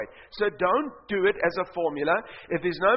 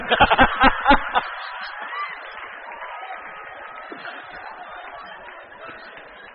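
big high laugh; 6 dB louder than usual. Real, not acted. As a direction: burst of high airy laughter. Big laugh after punchline.

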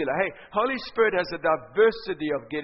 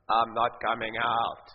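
much lower, slow demonic man's voice; 8 semitones lower, slower than usual. Retold or imitated. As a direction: growl it low and slow like a man inside her. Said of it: "a man's voice came out of her mouth".

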